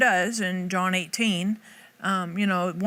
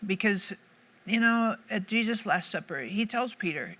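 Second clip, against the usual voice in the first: ordinary talk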